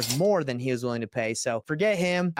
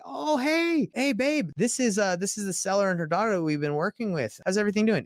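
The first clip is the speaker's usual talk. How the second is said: much higher, bright surprised hello to wife and seller; excited greeting; 7 semitones higher than usual. Retold or imitated. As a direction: greet them like a happy surprise run-in. Surprise meeting seller in store.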